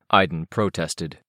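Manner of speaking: plain reading voice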